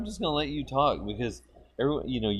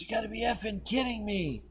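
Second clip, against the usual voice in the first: retold scream; 10 semitones higher, 7 dB quieter than usual. Retelling a man on shore yelling across the lake, mock scream. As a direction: imitate an outraged yell without real volume. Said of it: "screams at the guy in the middle of the lake".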